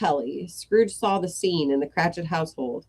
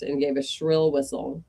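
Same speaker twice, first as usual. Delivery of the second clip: plain reading voice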